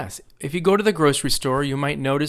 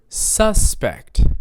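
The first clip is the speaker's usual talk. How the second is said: SUS-pect, slow with a strong first syllable; first-syllable stress; 7 semitones higher, 4 dB louder than usual. Shown as a demonstration. Teacher gives an example word, SUS-pect stressed on the first syllable. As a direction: punch the SUS in "suspect". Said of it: "I'm stressing a different syllable".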